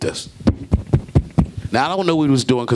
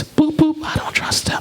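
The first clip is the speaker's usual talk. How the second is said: high beeps then hushed voice; car lock and whisper; slower than usual. Retold or imitated. Woman locking her Mercedes, whispering her distrust. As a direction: beep like a key fob, then whisper.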